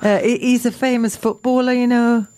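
playful put-on Geordie accent; chatty tale in a Geordie accent. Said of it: "why you're doing a Geordie accent about this".

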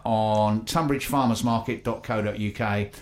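ordinary talk

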